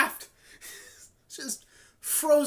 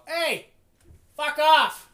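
shouting; 3 semitones higher, 8 dB louder, much slower than usual. Real, not acted. Yelling at a noise upstairs.

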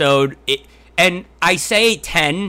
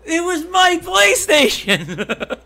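slow, high whiny complaint; 13 semitones higher, much slower than usual. Acted. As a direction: drawl it like a sulking kid. Mock quarrel, slow high voice.

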